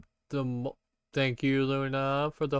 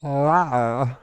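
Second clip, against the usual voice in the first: loud choked bear roar; gagged roar; 3 semitones higher, 8 dB louder than usual. Acted. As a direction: roar like a bear held by a harness. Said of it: "There's the gagged one".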